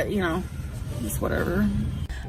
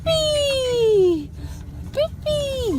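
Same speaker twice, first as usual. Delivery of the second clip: high, drawn-out squeal; 13 semitones higher, much slower than usual. Real, not acted. Owner calls to the dog in the car, cooing. Calling to the dog in baby talk.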